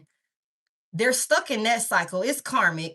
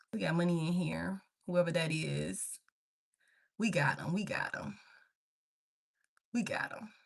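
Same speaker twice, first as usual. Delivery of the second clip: quiet aside; 5 semitones lower, 9 dB quieter than usual. Real, not acted.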